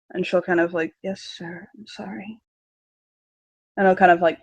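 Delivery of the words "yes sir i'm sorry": very soft, small contrite voice; meek apology. Acted. Daughter apologizing to her father, barely audible. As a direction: shrink and mumble your apology.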